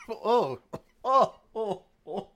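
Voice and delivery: coughing and spluttering; choking on powdered sugar. Real, not acted. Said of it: "I breathed in confectionery sugar and I nearly choked".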